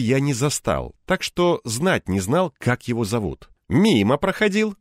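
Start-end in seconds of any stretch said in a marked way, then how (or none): 3.7-4.8 higher-pitched acid remark; biting, raised-pitch retort; caustic. A narrator's reading. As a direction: toss it off with a bite. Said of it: "едко заметил жидкобородый".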